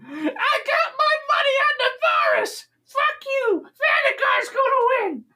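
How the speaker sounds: very high squealing little goblin cries; cheering goblins. Acted. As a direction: cheer like a high-pitched goblin betting on a fight. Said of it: "they're all cheering".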